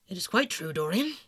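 measured, grave confirmation. A narrator's reading. Lord Henry confirms a death to Dorian, grave. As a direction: state it firmly and seriously. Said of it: "said Lord Henry gravely".

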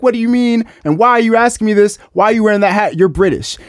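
firing questions back in disbelief; mock exasperated retort. He argues back with protesters.

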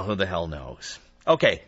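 ordinary delivery

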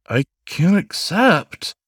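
high, slow, flustered protest; sputtering. Acted. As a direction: stammer it out, overwhelmed. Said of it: "the man sputtered".

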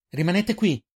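high urgent shouted order; urgent shriek. A narrator's reading. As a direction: cry out the order, pitched well up. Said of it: "strillò Eirin prima di correre".

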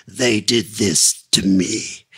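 low, slow, lurching hunchback groan; mock Igor lament, slow and low. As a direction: moan it like Igor greeting you at the dungeon door. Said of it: "like meeting Igor on my way to the dungeon".

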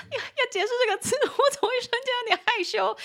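high, rushed, flustered squeal; real embarrassment, pitch shooting up; suddenly embarrassed. Real, not acted. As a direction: get flustered and shy over an awkward word.

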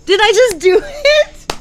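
high, slow, laughing disbelief; thrilled disbelief. Real, not acted. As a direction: squeal it out, amazed at yourself. Thrilled after saying sunsphere.

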